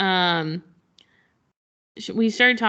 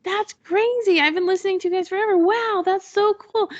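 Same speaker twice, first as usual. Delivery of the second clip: starstruck fan; 12 semitones higher than usual. Retold or imitated. Fan gushes to the podcast host, starstruck and excited. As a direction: squeal like you met your idol.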